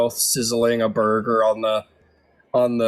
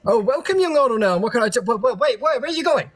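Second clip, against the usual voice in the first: much higher, bright innkeeper greeting turning to alarm; high-pitched tavern keeper voice; cheery barkeep; 12 semitones higher, faster than usual. Acted. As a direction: play the welcoming barkeep calling after someone. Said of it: "from the barkeep goes".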